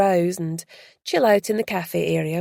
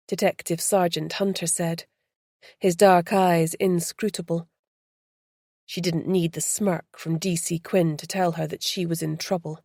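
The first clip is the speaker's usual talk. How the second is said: ordinary delivery, nothing marked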